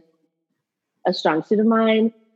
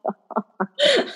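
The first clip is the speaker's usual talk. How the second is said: laughing slogan; 8 semitones higher than usual. Real, not acted. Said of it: "repeating slogan laughing".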